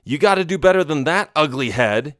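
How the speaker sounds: quick, high-pitched jeer; fast mock yell starting high; yelled taunt. A narrator's reading. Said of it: "he yelled, taunting it".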